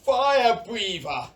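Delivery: shouted names. Acted. Villagers trading dragon names.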